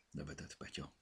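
plain reading voice

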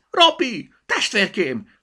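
very high, loud bunny shout; little rabbit's excited squealing cry. A narrator's reading. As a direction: call joyfully to a long-lost sibling. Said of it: "kiáltott fel Zorka".

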